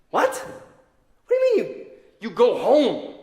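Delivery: high, loud disbelieving cry; incredulous. Acted. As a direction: shout it as if you can't believe it. Incredulous at disciples leaving.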